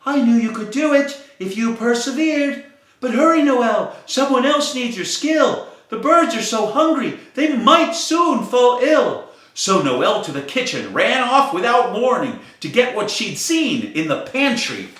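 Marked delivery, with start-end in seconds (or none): 0.1-9.2 higher, louder, urgent firefly; firefly cheering then urging, slowing at the end. Acted. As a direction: hurry her along, proud and pressing. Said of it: "The firefly circled Noel as he cheered".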